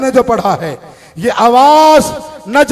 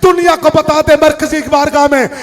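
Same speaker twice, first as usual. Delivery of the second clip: fiery preaching; 5 semitones higher than usual. Acted. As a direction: preach it hard and call it out. Fiery preaching before the mourners. (जोशीला ख़िताब, आख़िर में बुलंद आवाज़)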